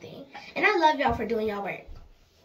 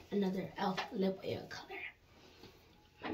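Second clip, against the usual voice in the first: whisper; 4 semitones lower, 11 dB quieter, slower than usual. Real, not acted. Trailing whisper about lip oil.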